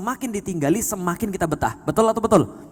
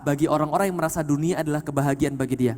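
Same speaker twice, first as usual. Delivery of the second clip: ordinary delivery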